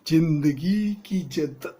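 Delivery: slow, loud, overdone delivery; overacted emotion. Hero overacting the line to bring emotion. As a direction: ham it up with fake emotion. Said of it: "ये क्या कर रहा है?".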